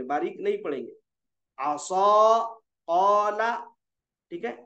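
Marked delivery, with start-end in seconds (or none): 1.6-3.5 आसा and कौला with long rounded "aw" vowels; thick, full alif. Shown as a demonstration. Teacher repeating pur examples, आसा and कौला held thick. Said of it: "मोटा पढ़ेंगे".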